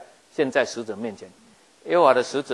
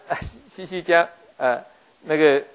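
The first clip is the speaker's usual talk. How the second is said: ordinary talk, nothing marked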